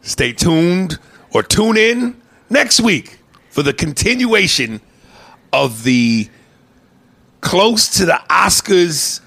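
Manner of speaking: drawn-out mock announcer voice; announcer read. Host teases next week's show, mock announcer.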